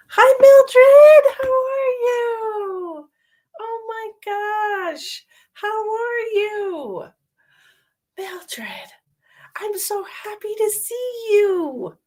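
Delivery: high, sing-song gushing hello, drawn out slowly; gushing greeting. Real, not acted. Psychic greets regular viewer Mildred on the stream, high gushing delight. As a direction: coo over a long-lost friend, slow and high.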